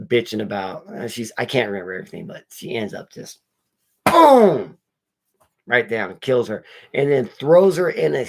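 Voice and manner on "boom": loud, much higher mock impact "boom"; sound-effect "boom", shouted up high. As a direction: punch out "boom" like a crash. Retelling the killing blow.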